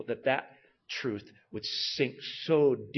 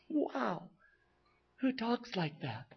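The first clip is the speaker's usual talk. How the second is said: hushed awe; 10 semitones higher, 10 dB quieter, slower than usual. Real, not acted. Awed aside.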